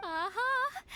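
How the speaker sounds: high, bright exclamation of discovery; pleased to find it; triumphant aha. Acted. Fortune teller announcing a discovery, triumphant.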